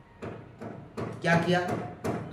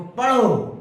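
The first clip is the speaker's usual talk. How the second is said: higher, louder single-word order; guardian's command; 5 semitones higher, 7 dB louder, much slower than usual. Retold or imitated. Guardian ordering the child to study, loud and sharp. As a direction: snap it out like a stern guardian. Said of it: "बहुत सारे guardian क्या करते हैं".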